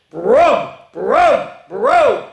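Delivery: loud, high rolled brum noises; silly sounds. A narrator's reading. Reader forced into silly sounds, loud revving noises. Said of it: "make silly sounds like".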